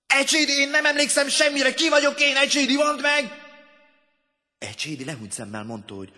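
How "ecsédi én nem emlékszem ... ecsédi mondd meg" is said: high, loud pleading; desperate shout. A narrator's reading. Man begging his classmate for his name, desperate shout. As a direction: plead at the top of the voice.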